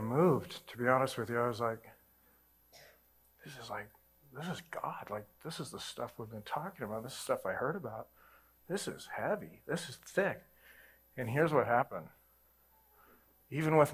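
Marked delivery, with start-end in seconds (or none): 3.4-10.4 much softer, hushed and reverent; awed hush. Retold or imitated. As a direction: breathe it out in quiet awe. Awed realization of God during prayer.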